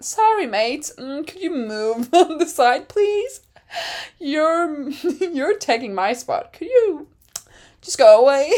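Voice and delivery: high-pitched, mock-polite request, breaking into laughs; George Russell. Imagined driver asking a rival to move, mock-polite.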